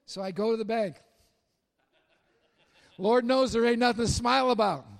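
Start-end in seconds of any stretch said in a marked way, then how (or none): 3.0-4.9 loud, laughing through the words; laughing aside. Real, not acted. Preacher joking to the congregation, laughing loudly. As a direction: chuckle it out to the crowd.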